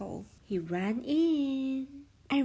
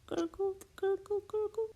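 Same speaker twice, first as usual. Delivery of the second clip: stomach gurgle; 9 semitones higher than usual. Acted. Stomach rumble sound effect.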